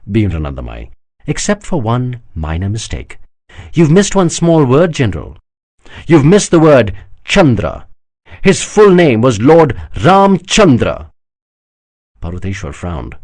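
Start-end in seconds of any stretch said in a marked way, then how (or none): 1.3-11.1 slow, pointed, savouring the word Chandra; triumphant correction. Acted. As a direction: lay it down slowly to win. Anandamai beams, pressing her point.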